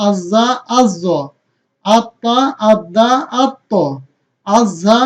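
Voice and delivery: slow, evenly spaced recitation; rhythmic drill. Shown as a demonstration. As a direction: chant each word as a measured classroom drill. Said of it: "ছন্দ আকারে বলি".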